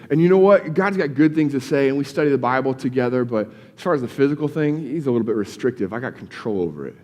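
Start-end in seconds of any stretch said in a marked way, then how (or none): 5.9-7.0 low, quiet, self-satisfied; smug self-assurance. Retold or imitated. Believer telling himself he has it handled, quiet and smug. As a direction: mutter it like a man sure he's in charge.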